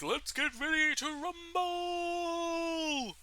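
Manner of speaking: high, long-held announcer call; ring announcer shout. Acted. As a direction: hype the fight, stretching the last word.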